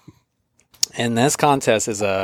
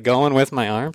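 ordinary delivery

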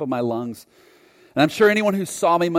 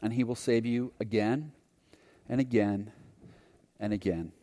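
gentle message; 3 semitones lower, 7 dB quieter than usual. Retold or imitated. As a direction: tell him tenderly, letting it fade. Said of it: "He said".

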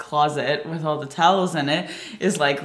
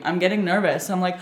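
usual speaking voice

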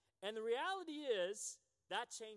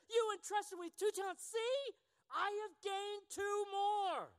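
servant reporting in a much higher, louder voice; 11 semitones higher, 5 dB louder, slower than usual. Acted. Preacher voicing the proud second servant, high and loud. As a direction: pitch the eager servant up high.

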